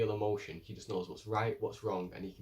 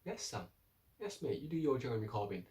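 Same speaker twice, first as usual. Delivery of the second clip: usual speaking voice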